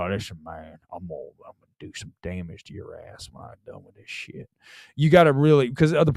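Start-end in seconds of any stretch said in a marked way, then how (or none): 0.0-4.5 very quiet, low Southern menace. Shown as a demonstration. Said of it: "your teeth go down".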